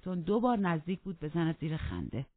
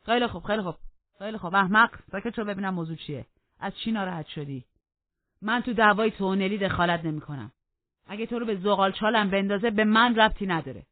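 loud, high exasperated interruption; impatient cutting off; 3 semitones higher, 8 dB louder than usual. Acted. Heathcliff cuts off Joseph's complaint, loud and impatient. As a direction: shut him up impatiently.

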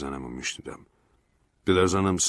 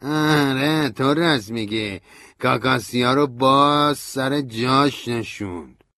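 higher, slow scornful voice; Stanley's bitter sneer; 7 semitones higher, 6 dB louder, slower than usual. A narrator's reading. Stanley answers narrator with bitter sarcasm, higher voice. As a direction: spit it back sarcastically.

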